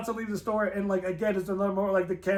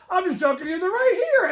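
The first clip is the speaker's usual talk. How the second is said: high, loud teasing reveal; 10 semitones higher, 6 dB louder than usual. Retold or imitated. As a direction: tease him, then reveal. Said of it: "he's like".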